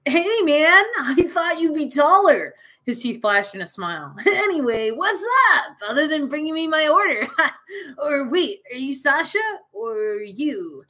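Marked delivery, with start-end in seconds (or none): 0.1-2.6 high, loud surfer-dude voice; a hammed-up slacker dude, pitched up; Matthew Lillard voice. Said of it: "I'm gonna give him a Matthew Lillard voice". 4.2-10.8 high goofy dude voice breaking into laughs; high, laughing slacker impression; Matthew Lillard voice. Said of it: "that's my best Matthew Lillard impression".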